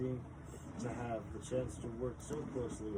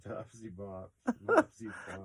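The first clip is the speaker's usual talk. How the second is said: silly teasing; 7 dB louder than usual. Pretend.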